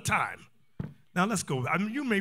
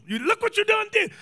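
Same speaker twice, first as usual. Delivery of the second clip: high, loud whine of blame; mock tearful accusation; 13 semitones higher, 5 dB louder than usual. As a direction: imitate a wife crying out blame. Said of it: "Stop that crying".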